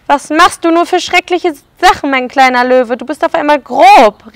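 a hint of a scolding lady; lady crying out. The lady scolding her pet lion, mild dismay. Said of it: "rief die Dame".

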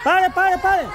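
shouting stop. Real, not acted. Game host shouting at players to halt. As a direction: yell at the players to freeze.

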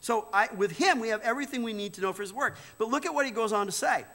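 ordinary talk

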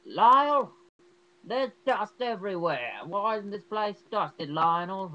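loud, high-pitched scolding mother impression; hammed-up overbearing mom, high and slow; nagging mother. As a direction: screech a drawn-out complaint as the mother. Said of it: "his mother who doesn't appreciate it".

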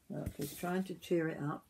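ordinary delivery, nothing marked

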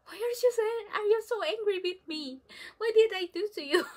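hammed-up child's protest; mock plea. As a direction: act the hurt kid, half laughing. Mock complaint at angry mom.

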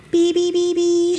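high, loud honk-honk noises; honking horn. Pretend. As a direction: beep like a toy truck horn. Imitating the truck horn.

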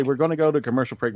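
usual speaking voice

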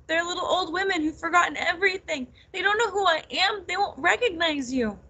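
high, distressed outpouring; tearful. A narrator's reading. Nettle tells her cousin about their mothers, tearful. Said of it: "she burst into tears".

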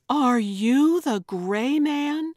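high, loud, drawn-out amazed question; awed gasp. A narrator's reading. Andrea guesses who Dave really is, gasping in amazement. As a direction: gasp it out slowly, voice soaring in astonishment. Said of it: "Andrea gasped".

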